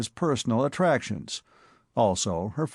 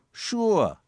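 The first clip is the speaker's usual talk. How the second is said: put-on dogged Bowery accent; 9 semitones higher than usual. A narrator's reading. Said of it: "said the Bowery boy doggedly".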